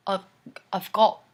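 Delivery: tentative, badly faked Irish accent; half-hearted Irish accent; attempted Irish accent. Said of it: "I can't even do an Irish accent".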